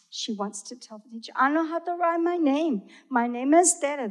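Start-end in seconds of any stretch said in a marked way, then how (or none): 1.3-4.1 put-on eager child. Child eager to tell teacher.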